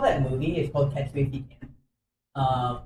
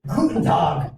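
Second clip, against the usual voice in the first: hammed-up fake German accent, laughing; a joke German accent thrown in while laughing; mock German accent; 5 semitones higher, 4 dB louder, slower than usual. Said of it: "you do an English accent?".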